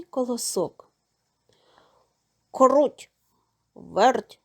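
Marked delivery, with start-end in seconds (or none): 2.5-4.4 rooster calling the mice loudly. A narrator's reading. Rooster calls the mice to come, calling out. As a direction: call them across the yard. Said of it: "став гукати півник".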